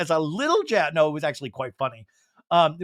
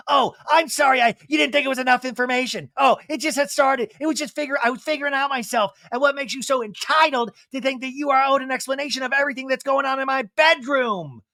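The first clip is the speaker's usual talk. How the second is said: higher, mock-sarcastic rant; sarcastic Kyle; 8 semitones higher than usual. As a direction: play Kyle snapping back with fake apology. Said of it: "Kyle sarcastically is like".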